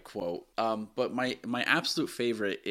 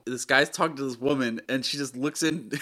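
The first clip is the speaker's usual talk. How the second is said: ordinary delivery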